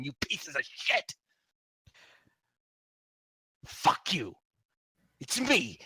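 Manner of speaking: high, loud furious cursing; angry cursing. Acted. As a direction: spit every fuck you at them. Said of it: "he sounds angry".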